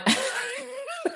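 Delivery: high-pitched burst of laughter; helpless laugh. Real, not acted. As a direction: crack up mid-sentence. Cracks up mid-protest about accents.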